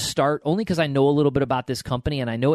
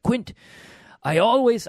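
put-on a brief, much higher try at an Irish accent that gives up fast; half-hearted Irish accent, pitched up; Irish accent attempt; 10 semitones higher than usual. Quoting the billionaire in a failed Irish accent. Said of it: "he had an Irish, uh, accent I won't be able to do".

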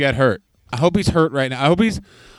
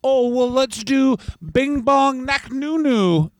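high, slow mocking voice of the co-host; hammed-up imitation of the co-host, pitched high; 8 semitones higher, slower than usual. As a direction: parody his boring pick. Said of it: "every single episode would just be".

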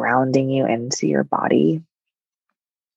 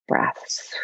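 soft whisper; 6 dB quieter than usual. Acted. Whispered meditation cue.

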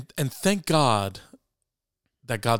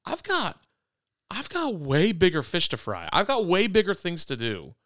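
higher, slow, puffed-up dismissive boast; mock arrogant brush-off; 7 semitones higher than usual. A man parodying his own rude words to a friend, higher and smug. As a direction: mockingly overplay your own self-important put-down. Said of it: "I didn't say it like that".

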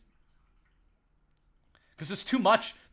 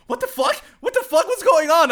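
high, loud imitated freakout; mock outburst; 14 dB louder than usual. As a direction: play her shocked outburst. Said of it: "And she's like".

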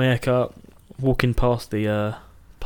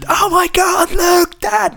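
put-on much higher, louder screaming kid; excited scream; 6 dB louder, much slower than usual. Eight-year-old screaming to dad over a Charizard, high excited shout. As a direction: shriek it out like a thrilled child. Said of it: "I remember screaming my lungs out".

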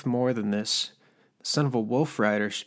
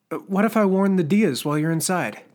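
defiant threat; 8 semitones higher, 9 dB louder than usual. Acted. Turner threatens to warn the Dias.

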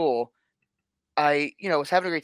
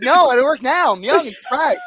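louder and higher, protesting; indignant protest; 8 semitones higher, 9 dB louder than usual. Retold or imitated. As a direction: insist you're young. Protesting to teacher, retold.

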